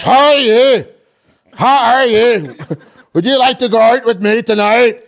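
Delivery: loud, high mock Northern Irish bellow; hammed-up terrifying Northern Irish come-on; Northern Irish accent. Girlfriend asking him out, booming Northern Irish accent. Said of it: "so her accent is fucked".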